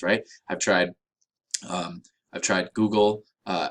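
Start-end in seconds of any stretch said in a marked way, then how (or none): none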